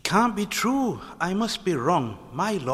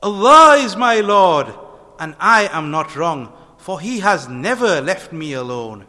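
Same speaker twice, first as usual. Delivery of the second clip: joyful shout; 6 semitones higher, 11 dB louder than usual. A narrator's reading. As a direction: proclaim it with joy. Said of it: "shouted with joy".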